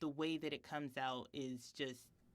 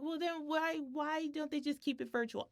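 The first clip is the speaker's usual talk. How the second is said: mock child voice; 10 semitones higher than usual. Mother quotes her seven-year-old son.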